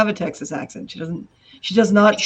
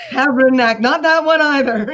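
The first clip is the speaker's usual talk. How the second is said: higher, louder mock motherly scolding; 6 semitones higher, 6 dB louder than usual. Mother banning French swear words, imitated high exasperated scolding. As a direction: act out forbidding another swear word.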